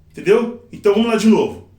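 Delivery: usual speaking voice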